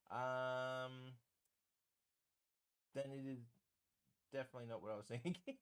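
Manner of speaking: ordinary talk, nothing marked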